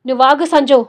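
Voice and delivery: angry scolding. Acted. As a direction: cut her off in anger. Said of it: "కసిరించాడు".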